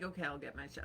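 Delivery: low soft meek voice; imitating husband. Husband giving in, low meek mumble. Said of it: "He's like".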